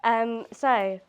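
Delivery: ordinary talk, nothing marked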